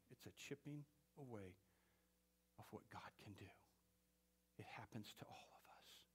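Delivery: hushed preacher's whisper; softly whispered warning. Real, not acted. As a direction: drop to a whisper for weight.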